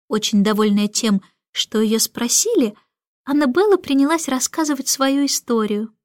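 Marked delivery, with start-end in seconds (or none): none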